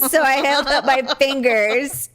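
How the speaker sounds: ordinary talk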